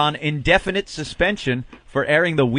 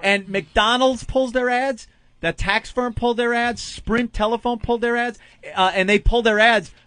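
higher, building list of pulled ads; excited emphasis; 8 semitones higher than usual. Real, not acted. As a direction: stack each one higher. Listing sponsors, rising emphasis.

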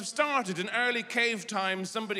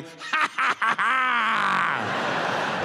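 [name] imitation; 3 semitones higher, much slower than usual. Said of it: "suddenly it's [name] going".

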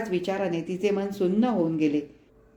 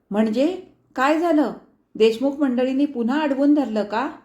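fearful question; 5 semitones higher, 4 dB louder than usual. A narrator's reading. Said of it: "भीतभीत विचारले".

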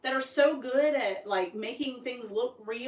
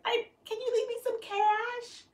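high-pitched mock begging; retold plea; 12 semitones higher than usual. Wife asks husband leaving town for cash, high pleading voice. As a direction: ask sheepishly, way up high.

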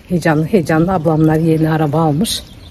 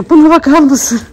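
higher and louder, delighted at the dog; amused exclaim; 7 semitones higher, 6 dB louder than usual. Real, not acted. To the viewers about the dog, a higher, louder amused exclaim.

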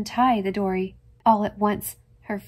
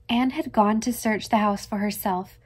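ordinary delivery

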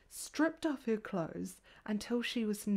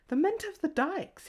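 higher, louder insistent repeat; 7 semitones higher, 5 dB louder than usual. A narrator's reading. The porter stubbornly repeats the name to the girls. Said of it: "he repeated".

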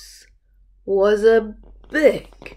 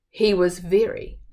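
usual speaking voice

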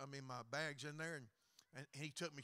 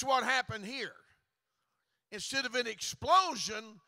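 full-force preaching; 6 semitones higher, 15 dB louder than usual. Acted. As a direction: preach it hard to the congregation.

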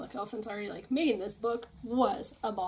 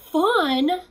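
loud disbelieving question; incredulous fun; 7 semitones higher, 11 dB louder than usual. Acted. Gerald stunned that the secret is fun, incredulous. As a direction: throw the word back in disbelief.

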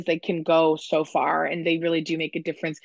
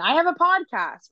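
much higher, sing-out quote; chirpy sample pitch; 10 semitones higher, 3 dB louder than usual. Retold or imitated. Getting anyone to come on just by saying it, chirpy sample pitch. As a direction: pitch it high, as an imagined announcement.

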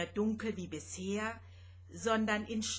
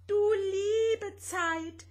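much higher, loud and slow lament; 11 semitones higher, 6 dB louder, slower than usual. Acted. Rosetta overwhelmed by millions of questions, slow high lament. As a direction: wail it out in dismay. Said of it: "klagte Rosetta".

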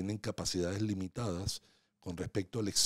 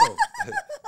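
sudden high laugh; burst of laughter; 6 dB louder than usual. Real, not acted. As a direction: laugh at his own tongue slip. Laughs at his own stumble.

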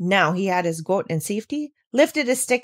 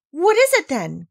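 eager cry; 8 semitones higher, 4 dB louder than usual. Acted. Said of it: "cried Heidi".

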